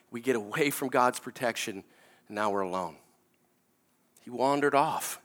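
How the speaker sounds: low, soft, weighty pauses; solemn preaching. Real, not acted. Preacher applying the silly story to sin, solemn. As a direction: bring it down and let the lesson sink in.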